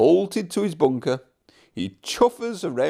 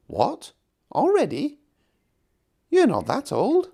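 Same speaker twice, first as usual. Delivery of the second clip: high, teasing and cheeky; 12 semitones higher than usual. Acted. Thomas teasing Edward about his age, cheeky and high. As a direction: tease an old friend, pitched up high. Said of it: "replied Thomas cheekily".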